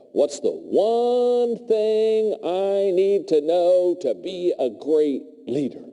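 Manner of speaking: drawn-out, slow earnest asking, a hint of intensity; intense question. MBA kid pressing the speaker, mildly intense and slow. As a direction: mimic the eager student pressing hard. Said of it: "he's very intense".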